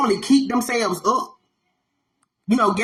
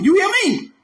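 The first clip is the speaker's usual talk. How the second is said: ordinary delivery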